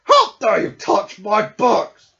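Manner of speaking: loud, slow furious scolding; angry bear. Acted. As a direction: scold the little thieves.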